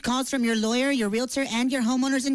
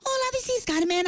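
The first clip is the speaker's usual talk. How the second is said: sing-song greeting; 8 semitones higher than usual. Acted. Prank caller calls back brightly.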